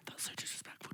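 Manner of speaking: whispered mock telling-off; imitated hushed reproach; hushed scolding. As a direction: whisper it urgently, barely audible.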